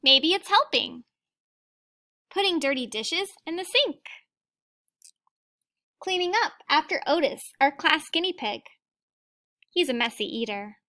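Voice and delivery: put-on reading a picture book aloud to children; gentle read-aloud storytelling; teacher reading to the class; story reading. A narrator's reading.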